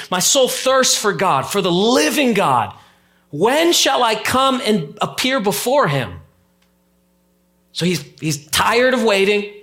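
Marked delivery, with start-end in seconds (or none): 0.1-6.2 slow, higher reading with weight on soul; slow emphatic reading. Shown as a demonstration. Pastor savours the psalm's language for the church, slow and emphatic. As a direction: lean into "soul" and stretch the line. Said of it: "Listen to the language".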